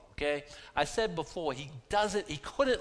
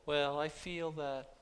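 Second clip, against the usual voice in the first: slow, mildly mocking take on a feelings-first believer; mocking feelings quote; 3 semitones higher, 3 dB quieter, slower than usual. Pastor mocking emotion-led believers to congregation, slow and wry. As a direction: drawl it out as a gentle send-up. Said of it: "We don't go".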